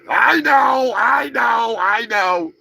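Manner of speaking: high, loud, over-the-top I know; mock defensive cry. Real, not acted. Host confessing peanut butter in coffee, loud mock-guilty pleading. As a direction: throw up your hands and plead guilty, loud. Admitting coffee blasphemy to viewers.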